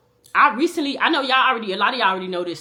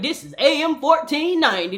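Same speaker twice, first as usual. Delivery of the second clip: mock radio station ID, raised and bright; radio announcer voice; 7 semitones higher, 5 dB louder than usual. Imitating the radio station announcer.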